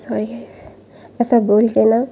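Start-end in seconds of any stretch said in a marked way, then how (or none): 0.1-0.5 hushed apology to the viewers; quick whispered aside to the audience; quiet aside. Real, not acted. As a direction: drop to a near-whisper, sorry guys. Narrator apologises mid-story.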